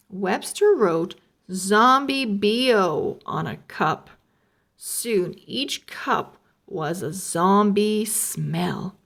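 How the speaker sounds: usual speaking voice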